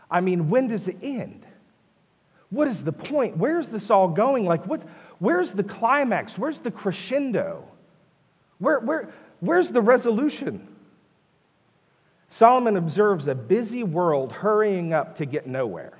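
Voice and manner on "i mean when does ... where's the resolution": high, pleading exasperated questions; mounting acted frustration, each question lifted high; frustrated. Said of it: "Can you feel his frustration?".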